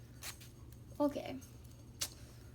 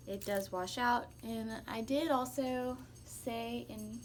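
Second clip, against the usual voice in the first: ordinary delivery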